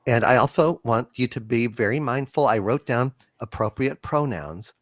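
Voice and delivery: low, soft, halting, timid delivery; meek, over-careful game master parody; woke dungeon master. Acted. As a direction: speak gently and haltingly, careful to offend nobody. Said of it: "What is a woke dungeon master like".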